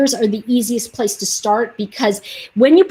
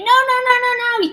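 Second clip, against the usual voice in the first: put-on high, whiny kid protest; 14 semitones higher than usual. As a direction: whine it like a little kid. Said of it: "I felt like the kid is going".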